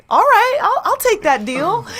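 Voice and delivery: imitated stoked dude; mock eager stranger. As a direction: jump at the deal. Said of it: "And he was like".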